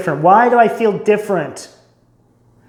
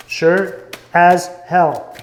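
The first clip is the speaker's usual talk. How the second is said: very slow, every word drawn out; slow emphatic; much slower than usual. Shown as a demonstration. Repeating it to the listener, slow and emphatic. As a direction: lean into it word by word.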